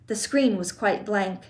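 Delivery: ordinary delivery, nothing marked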